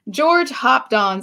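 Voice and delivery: usual speaking voice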